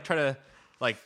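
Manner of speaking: ordinary delivery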